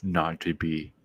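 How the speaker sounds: decisive. Retold or imitated. Arnold's Hamlet after the blast, low and final. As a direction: close the case, a hint of the action hero. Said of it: "he was decisive".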